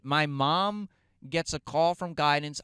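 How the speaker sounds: normal talking voice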